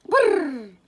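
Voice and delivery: loud, high shiver from the cold; shivering brrr. A narrator's reading. Fawn touches cold water.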